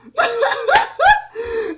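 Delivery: high, loud squealing cackle; shrieking laugh. Real, not acted. Reacting to one-handed solve, shrieking laugh. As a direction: burst into a long piercing laugh.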